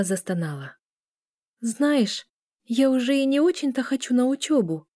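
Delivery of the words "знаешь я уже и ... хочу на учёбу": higher-pitched weary confession; confessing. A narrator's reading. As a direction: admit it reluctantly, voice lifted. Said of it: "призналась Лиля".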